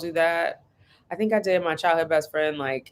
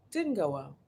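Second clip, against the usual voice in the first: much softer, dry echo; soft deadpan repeat; 8 dB quieter than usual. Shown as a demonstration. Repeating that the party went badly, soft and dry. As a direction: drop it flat under your breath.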